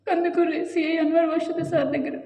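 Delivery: high, tearful wail fading to speech; still crying. Real, not acted. Mother recalling her plea to the officer, still weeping. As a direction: keep the sob in the voice as it eases. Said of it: "ఎంత మొత్తుకుని ఏడ్చాను".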